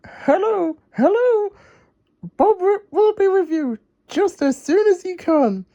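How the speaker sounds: high Wendy voice, reassuring callers; calm the worried customers, up high; promising reassurance. Acted. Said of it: "she promised the worried customers".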